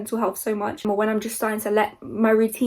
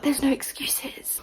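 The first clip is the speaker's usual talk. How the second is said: whisper; 4 semitones higher, 5 dB quieter, slower than usual. Real, not acted. Firm motivational aside.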